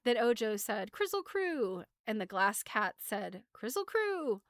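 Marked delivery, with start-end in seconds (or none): 0.9-1.9 high-pitched chant call; high call at ordinary volume. Acted. Ojo chants the angering words. 3.5-4.4 very high-pitched little call; high voice at ordinary volume. Acted. Cat says the angering words.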